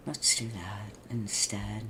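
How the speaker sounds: soft slowed whisper; hushed slow whisper to yourself. Real, not acted. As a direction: whisper it as a private decision. Repeats the line whispered.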